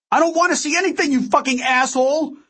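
high, fast enraged outburst; very high-pitched angry yell; furious scream. A narrator's reading. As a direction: scream it in desperate anger. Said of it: "I screamed".

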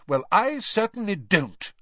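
high-pitched, self-righteous objection; sanctimonious. A narrator's reading. Percy objecting to a long match, sanctimoniously. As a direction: disapprove as if above such fun. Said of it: "said Percy sanctimoniously".

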